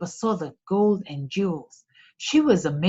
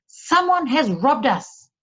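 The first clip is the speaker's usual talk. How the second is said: slow, loud, higher outcry; crying out; 6 semitones higher, 4 dB louder, slower than usual. A narrator's reading. Thief leader finds the cave robbed, slow loud outcry. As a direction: cry out at the theft. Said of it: "cried the leader".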